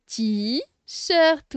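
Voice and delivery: "t-shirt" slowly split in two beats; teacher slowly sounding out "t-shirt"; syllable by syllable. Shown as a demonstration. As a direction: hold "t", pause, then "shirt". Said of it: "on fait toujours les syllabes".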